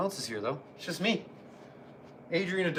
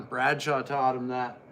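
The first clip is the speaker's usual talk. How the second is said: ordinary delivery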